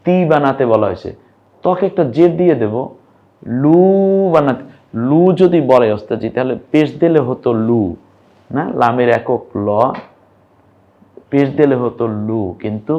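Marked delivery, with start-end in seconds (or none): none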